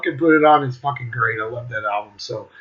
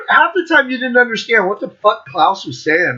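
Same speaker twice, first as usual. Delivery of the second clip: ordinary talk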